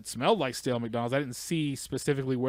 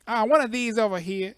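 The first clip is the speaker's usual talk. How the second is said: higher, louder lazy clerk voice; mock cashier voice; 9 semitones higher, 6 dB louder than usual. Cashier answering the customer, hammed up. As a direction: point vaguely like a bored clerk.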